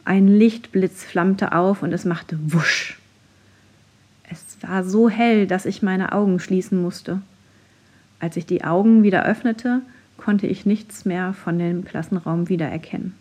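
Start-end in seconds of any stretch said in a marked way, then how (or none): none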